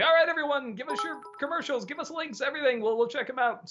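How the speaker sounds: high-pitched, upbeat announcement to followers; rally everyone, pitched well up; rallying call-out. Acted. Asking Facebook followers for commercial links, rallying call-out.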